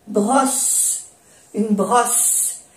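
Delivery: brosse with a crisp double S; French pronunciation. Shown as a demonstration. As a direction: sound both S in brosse. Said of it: "Se pronuncia bien las dos S". (brosse, pronunciando bien la doble S)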